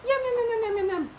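high, rapid-fire yums; squeaky nibbling chatter; munching mouse. Acted. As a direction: gobble like a tiny mouse.

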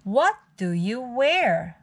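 usual speaking voice

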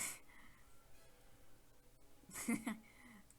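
long unvoiced wheezy laugh; breathy laughing. Real, not acted. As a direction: keep laughing breathily, fading. Continued laughter. (sessiz, nefesli gülme)

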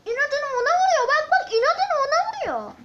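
high, loud exasperated yell at the game; shouting at the pet that keeps hitting the wrong target; frustrated shout. Real, not acted. Pet attacks the wrong enemy. (oyuna sinirlenip yüksek sesle bağırma)

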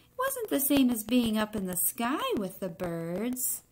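put-on expressive read-aloud to children; story reading. A narrator's reading. Teacher reading a picture book to the class, expressive narration. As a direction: tell the egg's story to the class, lightly dramatic.

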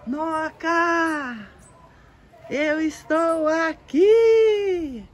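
high, loud, slow cry of the roots; the roots shouting to their owner; plants shouting. Pretend. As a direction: belt it out as the roots calling Noca.

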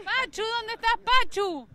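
high, loud call for someone absent; calling again for the missing clown. Real, not acted. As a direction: yell it out looking around. Host keeps calling for clown.

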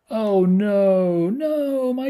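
high-pitched anguished cry; high squeal of dismay. Real, not acted. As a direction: squeal over the falling cats. Squeals as stack falls.

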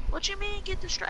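high, disbelieving squeak; incredulous mock voice. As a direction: act shocked at the request. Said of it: "Hey, yo, get the strap".

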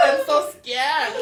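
slow, drawn-out mock whine; mock scared whine. Teller mocks friend's scared English, slow and whiny. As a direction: stretch each word like a baby. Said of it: "แล้วเราก็".

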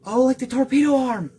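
loud, high-pitched burst of real excitement; excited outburst. Real, not acted. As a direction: cry out, thrilled by the discovery. Thrilled by torpedo arm in game.

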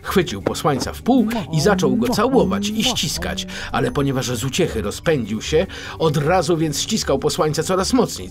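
plain reading voice

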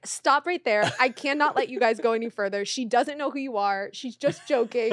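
loud, firm halt breaking into laughter; retold urgent interruption. Wife stops the prank on her husband, loud and urgent. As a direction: cut in loudly to call off a prank.

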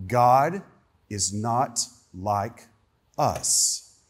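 God, then slow "is not like", whispered "us"; solemn slow emphasis; said very clearly. Shown as a demonstration. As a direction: spell out "not" with slow pauses. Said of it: "let me say it very clearly".

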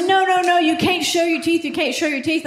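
high, insistent repeated protest; mock flustered objection. Clerk stopping a customer showing teeth, mock flustered. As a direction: imitate a clerk frantically forbidding it.

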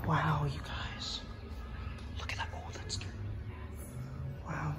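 hushed whisper to the viewers; whisper, as if not to disturb anyone. Real, not acted. Kid whispering to his viewers in the museum, hushed.

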